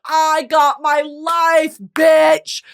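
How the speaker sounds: loud, high, drawn-out outburst; slow, emphatic hype yell; exuberant raving. Real, not acted. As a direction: belt it out in sheer delight. Raving about the film's style.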